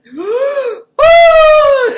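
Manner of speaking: hammed-up horror-film scream; mock terrified shriek. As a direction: gasp into a very high, loud scream. The tall man suddenly appears.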